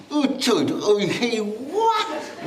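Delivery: high, laughing mock cries of delight; playful imitated yelps; mock grimacing exclamations. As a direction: act out a pained-but-pleased face. Mimicking listeners' grimaces of pleasure.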